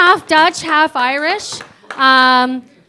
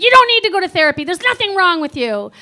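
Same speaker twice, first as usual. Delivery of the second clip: fast, higher-pitched dismissal; mock insistent mother, pitched up and rapid; blunt mom; 5 semitones higher, 3 dB louder, faster than usual. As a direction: imitate mom brushing it off in a rush. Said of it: "just tell it to me straight".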